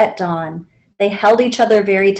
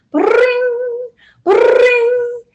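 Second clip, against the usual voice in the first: phone ring; 13 semitones higher than usual. A narrator's reading. Phone ringing in the story.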